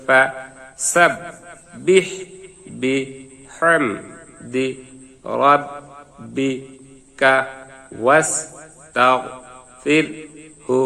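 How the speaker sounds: each syllable said alone with long gaps. Shown as a demonstration. Teacher to students, spelling out the verse syllable by syllable. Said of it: "আবার ভাঙ্গা ভাঙ্গা উচ্চারণ".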